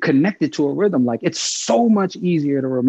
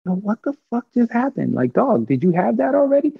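pitched way up in retold shock; stunned disbelief; 9 semitones higher than usual. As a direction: blurt it out, stunned, like you can't believe what you just heard. Retelling his stunned reaction.

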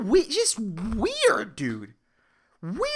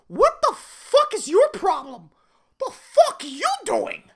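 high-pitched, incredulous outburst; exasperated rant; 11 semitones higher than usual. Pretend. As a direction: blurt it out in disbelief. Imagined rant at the coworker.

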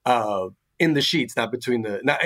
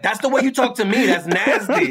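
higher, faster disgusted quote; disgusted partner; 7 semitones higher than usual. Retold or imitated. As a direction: sound grossed out. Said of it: "she's like".

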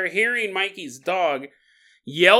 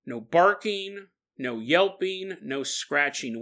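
ordinary talk, nothing marked

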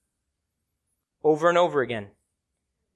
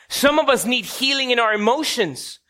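ordinary talk

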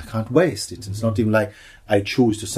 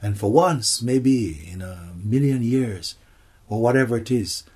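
ordinary talk

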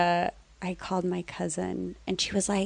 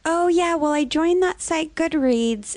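aunt's breezy reply; 9 semitones higher, slower than usual. Retold or imitated. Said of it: "And she's like".

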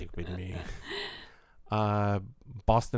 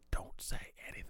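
whispering; 12 dB quieter, faster than usual. Shown as a demonstration.

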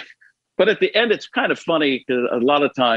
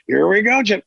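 smiling, gushing broadcaster voice; Tony Romo impression grinning to Jim; 3 semitones higher than usual. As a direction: grin through every word of it. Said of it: "you can see him".

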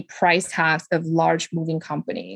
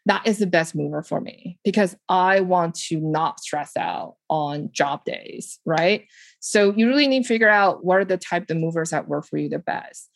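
normal talking voice

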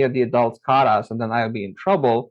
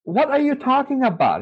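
incredulous question; 12 semitones higher than usual. Retold or imitated. Asking classmate about jinn.

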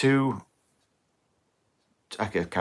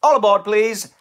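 put-on bellowing old station porter; 8 semitones higher, 4 dB louder than usual. After the train jingle.

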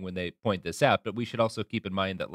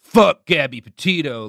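higher, louder hypothetical shout; loud mock outburst; 6 semitones higher, 14 dB louder than usual. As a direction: blurt it out loud and slow. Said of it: "we're not saying, like".

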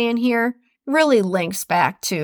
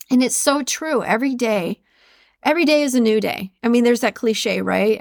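ordinary delivery

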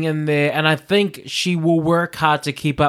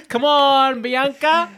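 excited cheer; 9 semitones higher, slower than usual. Real, not acted. Reacting to the show.

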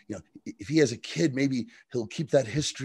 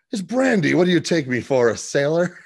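louder, higher mock indignation; very offended; 5 semitones higher, 6 dB louder than usual. Stein answering Jax about his drink, acted offence. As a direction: play the insulted professor, voice raised. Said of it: "Stein's very offended".

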